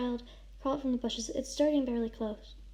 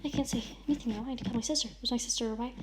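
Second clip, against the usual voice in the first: ordinary delivery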